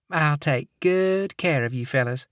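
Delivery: flirty Southern receptionist; Southern accent. Acted. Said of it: "saccharine Southern accent".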